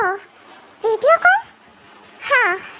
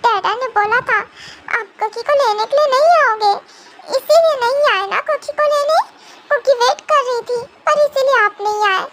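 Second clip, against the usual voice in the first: put-on higher babyish hurt voice; a little girl blaming him; childish accusation; 3 semitones higher than usual. Cookie accusing. (बच्चे जैसी नाराज़ आवाज़)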